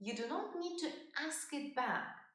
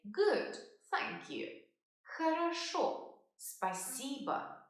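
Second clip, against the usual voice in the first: very slow, careful repetition; slow model answer; much slower than usual. Shown as a demonstration. Teacher demonstrating a polite reply slowly. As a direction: spell it out slowly for learners. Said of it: "and say".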